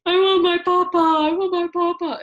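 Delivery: put-on high, loud wail of a little boy; imitated crying child. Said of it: "And he just starts crying".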